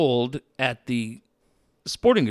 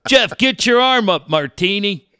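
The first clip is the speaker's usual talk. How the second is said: loud, higher call of his name; mock nurse call; 8 semitones higher, 7 dB louder than usual. Nurse calling him in, loud high mock call. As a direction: shout it down the hallway.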